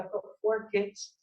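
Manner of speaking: ordinary delivery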